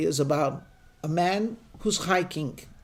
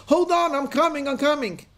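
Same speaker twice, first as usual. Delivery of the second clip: rescuer's shout; 9 semitones higher than usual. Acted. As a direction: shout down to the climber that help is near. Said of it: "someone shouts".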